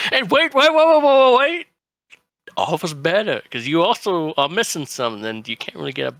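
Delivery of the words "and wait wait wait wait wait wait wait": high, loud calls of wait; urgent shouting. Acted. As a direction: call them back, urgent and loud. Character stops someone leaving.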